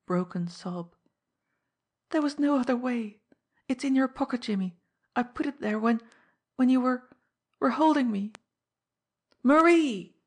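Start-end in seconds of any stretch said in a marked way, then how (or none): 2.1-8.3 anguished confession. A narrator's reading. Woman's anguished parting plea in story. 9.4-10.1 loud, high, desperate shout of a name; wild cry. A narrator's reading. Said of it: "he cried out wildly".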